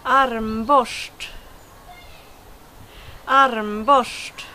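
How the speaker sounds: armborst with rs said as "sh"; Swedish armborst. Shown as a demonstration. Teacher showing viewers the word armborst, Swedish pronunciation. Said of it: "words that I should, uh, pronounce".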